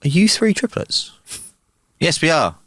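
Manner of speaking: lightly put-on Irish accent. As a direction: tell it with a joking Irish lilt. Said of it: "I like the Irish accent".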